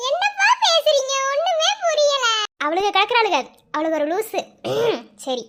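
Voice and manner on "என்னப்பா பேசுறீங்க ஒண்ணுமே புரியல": very high, drawn-out complaint; baffled whine. Acted. Woman protesting that their talk makes no sense, whining. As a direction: whine slowly in playful bafflement.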